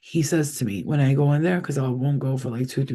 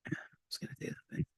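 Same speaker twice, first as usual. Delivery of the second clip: breathy whisper to herself, quick; 18 dB quieter, much faster than usual. Real, not acted. Trying to remember, whispering to herself. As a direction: hush it almost under her breath.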